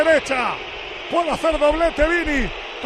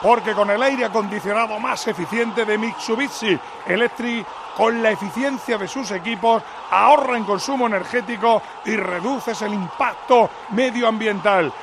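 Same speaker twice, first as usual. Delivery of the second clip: lower-pitched sponsor ad read; 4 semitones lower than usual. Acted. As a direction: read the ad like a spot.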